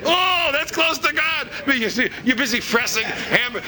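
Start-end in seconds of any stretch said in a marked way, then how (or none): 0.0-1.5 high, slow, fawning praise; over-the-top admirer crying out praise; mock gushing praise. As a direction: lay the flattery on thick. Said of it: "and they go".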